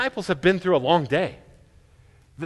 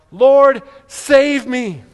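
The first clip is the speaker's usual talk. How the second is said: high, loud desperate cry; cry for help; 6 semitones higher, 7 dB louder, much slower than usual. Retold or imitated. Peter sinking in the waves, desperate loud cry. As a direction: call out as if sinking. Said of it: "he cried out".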